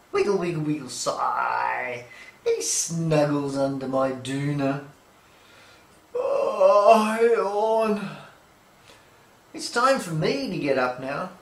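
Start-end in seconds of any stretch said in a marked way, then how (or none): 0.6-2.1 contented sigh. Acted. Dog settling down. 6.1-8.2 yawn. Acted. Dog yawning.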